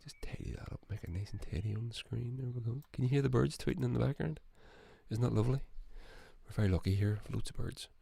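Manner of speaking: soft muttered aside about the birds; quiet aside. Real, not acted. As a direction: murmur it while fiddling with the screen. Aside while adjusting screen.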